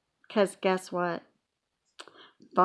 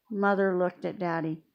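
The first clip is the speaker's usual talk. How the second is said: plain reading voice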